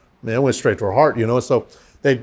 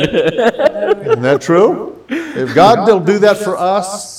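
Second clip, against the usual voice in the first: laughing; 5 semitones higher, 3 dB louder, much slower than usual. Real, not acted. Joking, laughing.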